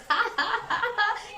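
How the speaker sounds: high bursting laugh; a loud demo laugh. Shown as a demonstration. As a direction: show a free laugh. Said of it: "laugh the way you laugh".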